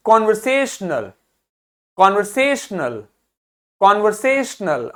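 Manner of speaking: light start, strong से in conversational; stress on से. Shown as a demonstration. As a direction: repeat conversational, each time stressing से. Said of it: "आपको से अलग से सुनाई देता है".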